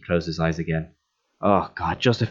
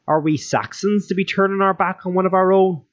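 indignant retort; 10 semitones higher than usual. Acted. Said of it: "retorted Donal".

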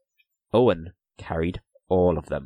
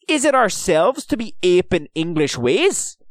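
loud, high-pitched indignant question; sputtering indignation; 10 semitones higher, 6 dB louder than usual. A narrator's reading. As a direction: throw the question back in outrage. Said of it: "sputtered Owen".

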